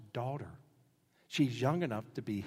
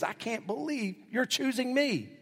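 amazed Boaz; 9 semitones higher than usual. Acted. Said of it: "He basically says".

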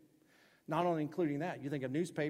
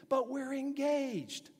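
high, slow pleading excuse; excuse-making churchgoer pleads, high and slow; whiny excuse; 11 semitones higher, 4 dB louder, much slower than usual. Retold or imitated. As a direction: whine it like a caught teen. Imitated excuse.

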